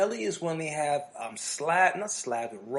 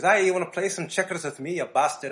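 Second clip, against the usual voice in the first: cocky fighter taunt; 3 semitones higher, 6 dB louder than usual. Retold or imitated. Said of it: "that dude will beat you at anything".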